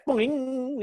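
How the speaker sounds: high springy boing; boing sound effect. Retold or imitated. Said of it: "that spring sound".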